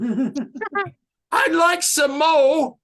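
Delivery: high, drawn-out, badly faked English shout; horrible English accent. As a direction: Oliver Twist plea in a hammy English accent. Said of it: "All right, horrible English accent".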